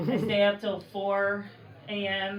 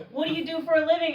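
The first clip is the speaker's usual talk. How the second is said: mock eager question; 7 semitones higher, much faster than usual. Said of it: "is a top question".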